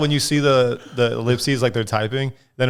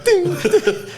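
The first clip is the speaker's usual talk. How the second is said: high ding ding notification; phone ding imitation. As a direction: chime it like a phone alert.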